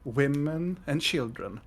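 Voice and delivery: normal talking voice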